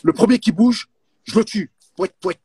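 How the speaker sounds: loud, high mock threat; angry. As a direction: bark the threat, then silly honks. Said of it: "tu dois me dire en colère".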